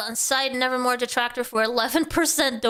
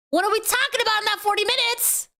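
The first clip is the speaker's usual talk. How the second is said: usual speaking voice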